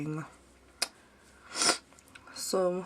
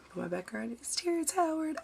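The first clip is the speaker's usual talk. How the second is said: pitched way up with delight; excited; 10 semitones higher than usual. Real, not acted. Showing viewers her phone background, she says it excitedly up high. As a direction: gush about it proudly.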